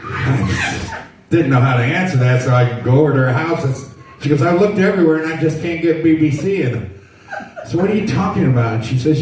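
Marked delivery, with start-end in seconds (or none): 4.4-6.6 put-on higher, puzzled old lady voice; a confused grandmother complaining; old mother fussing. 7.8-8.7 higher, baffled question; puzzled. Retold or imitated. Son answering mother.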